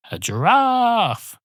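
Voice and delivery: giraffe stretched high and slow; overdone giraffe. Shown as a demonstration. Storyteller overdoing giraffe out of habit. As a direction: squeeze something out of giraffe. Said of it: "out of habit now".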